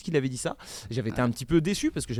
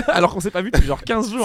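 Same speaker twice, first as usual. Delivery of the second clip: laughing; 7 semitones higher, 9 dB louder than usual. Real, not acted. Rit en se plaignant.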